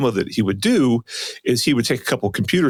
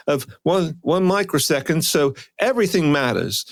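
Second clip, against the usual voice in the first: higher-pitched, badly faked Australian; bad Australian accent; 4 semitones higher than usual. Mocking the presenter's case for cables, bad Australian accent. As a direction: push on with a clumsy Australian impression. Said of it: "I really am bad at this".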